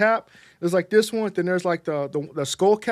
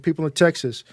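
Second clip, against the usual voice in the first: usual speaking voice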